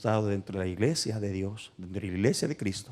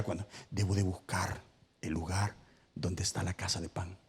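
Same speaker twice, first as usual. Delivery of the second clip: hushed whisper; dropped to a whisper for effect; 4 semitones lower, 8 dB quieter than usual. Acted. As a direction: breathe the word softly. Preacher's hushed point.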